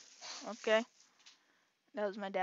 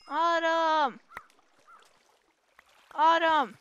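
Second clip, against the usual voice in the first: loud, raised, drawn-out call; hollered name, held long; calling a dog; 4 semitones higher, 7 dB louder, slower than usual. Real, not acted. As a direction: call the lost dog's name out. Calling a lost game dog.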